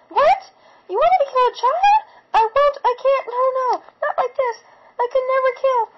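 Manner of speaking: high, loud desperate yell. A narrator's reading. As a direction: refuse at the top of the voice. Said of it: "I was yelling at her".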